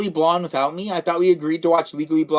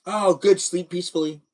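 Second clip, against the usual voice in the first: normal talking voice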